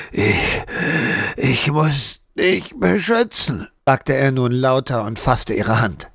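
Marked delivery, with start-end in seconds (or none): 0.1-3.7 hoarse and strained. A narrator's reading. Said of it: "Er war heiser von der Schreierei".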